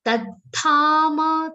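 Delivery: Tadthama chanted slowly, half t then full dha; careful pronunciation. Shown as a demonstration. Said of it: "ta is followed by half".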